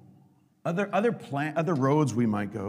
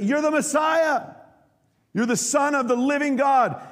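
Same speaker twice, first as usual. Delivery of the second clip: proclaiming confession; 12 semitones higher, 6 dB louder than usual. Retold or imitated.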